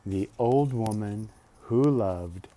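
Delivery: plain reading voice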